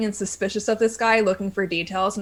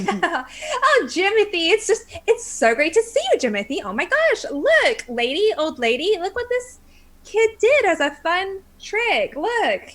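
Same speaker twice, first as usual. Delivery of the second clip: high, sing-song mock cheer; sweetly exposing the thief; fake-friendly; 8 semitones higher than usual.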